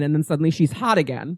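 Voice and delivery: ordinary delivery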